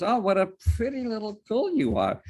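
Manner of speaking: high, sing-song praise; cooing conductor. Retold or imitated. Trolley conductor cooing at a little girl. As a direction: coo it like to a small child.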